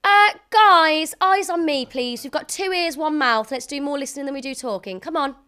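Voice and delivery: loud, high teacher calling the class to attention; exasperated schoolteacher projecting over chatter. Pretend. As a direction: scold a class that is not listening. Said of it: "pretend that no one's listening to you in class".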